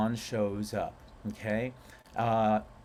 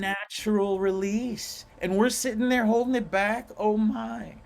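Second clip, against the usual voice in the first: higher and louder, amused; animated; 9 semitones higher, 4 dB louder than usual. Real, not acted. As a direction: rise up in amused disbelief. Amused exasperation about holding yawns.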